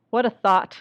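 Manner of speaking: ordinary talk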